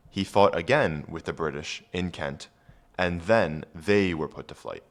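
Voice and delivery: ordinary talk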